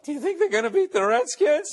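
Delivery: mock a boy whose voice is breaking; cracking puberty voice. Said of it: "my voice just started cracking as I was hitting puberty".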